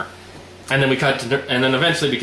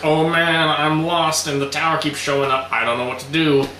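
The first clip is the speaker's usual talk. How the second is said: mock whining; 4 semitones higher than usual. Retelling Noah lost by the tower, mock whine. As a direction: imitate a lost man's complaint. Voicing lost Noah's complaint.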